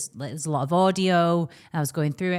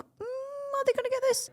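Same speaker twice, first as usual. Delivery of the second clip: doubtful; 5 dB quieter than usual. Retold or imitated. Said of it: "And I did think".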